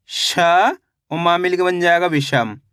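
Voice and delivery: slow syllable-by-syllable blending; teacher spelling a word aloud for children; sounding out syllables. Shown as a demonstration. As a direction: stretch each syllable then join them. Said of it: "teacher sounds out syllables".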